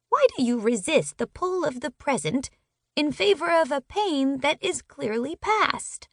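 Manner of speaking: a calm, wise bird asking gently; high-pitched bird. Acted. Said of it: "high-pitched bird impression".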